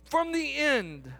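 high and loud, God speaking firmly to Moses; preaching at full force. Acted. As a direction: preach it with blunt divine authority. Preacher voices God proclaiming.

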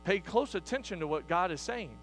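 ordinary talk, nothing marked

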